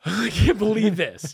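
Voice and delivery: high, giddy amazement; joyful disbelief. Retold or imitated. Visitor just sitting there as cousin recovers, giddy disbelief. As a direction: blurt it out, amazed and nearly laughing.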